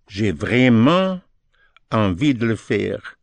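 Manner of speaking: slow, word by word on vraiment; slow demo. Shown as a demonstration. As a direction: spell out j'ai vraiment slowly, then ease into envie.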